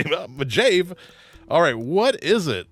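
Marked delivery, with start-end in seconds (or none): 0.0-0.9 imitating a Pittsburgh local saying "Mojave" as "muh-JAVE"; a slightly higher mock Pittsburgher, "Mojave"; Pittsburgh accent. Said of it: "somebody's gonna say".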